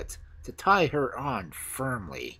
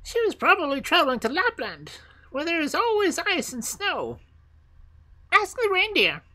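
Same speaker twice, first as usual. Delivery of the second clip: very high, piping bird voice; squeaky bird giving directions; wood pigeons; 5 dB louder than usual. Acted. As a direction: answer like a high-pitched pigeon.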